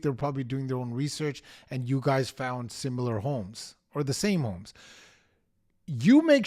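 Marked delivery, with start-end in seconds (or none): none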